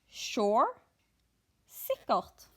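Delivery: ordinary talk, nothing marked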